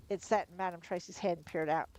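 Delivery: usual speaking voice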